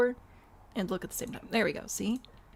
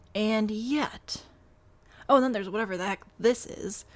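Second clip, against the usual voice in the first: normal talking voice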